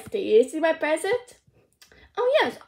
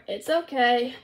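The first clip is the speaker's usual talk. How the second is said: normal talking voice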